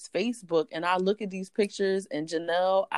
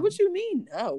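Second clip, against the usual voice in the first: very high-pitched mimicked disbelief; incredulous; 12 semitones higher than usual. Mama Rita hears she won't call him, incredulous. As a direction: challenge that refusal in a high voice. Retold incredulous challenge on phone.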